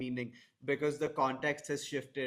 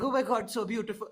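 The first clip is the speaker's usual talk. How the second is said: gushing mock quote; 9 semitones higher, 4 dB louder, faster than usual. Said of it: "and be like".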